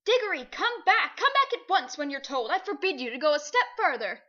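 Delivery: loud but timid calling; a scared uncle yelling from afar; half-hearted shout. A narrator's reading. Uncle Andrew calling Diggory back, loud but timid. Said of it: "shouting, but by no means at the top of his voice".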